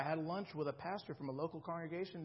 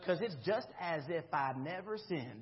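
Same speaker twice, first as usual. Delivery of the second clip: lightly imitated American southern accent; Gordon Ferguson southern accent. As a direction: keep a hint of the southern accent. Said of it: "he talked like in a southern accent".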